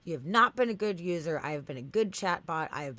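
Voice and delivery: hammed-up chatbot telling-off; indignant scolding. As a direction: slam down each accusation as the offended bot. Acts out chatbot's angry rejection.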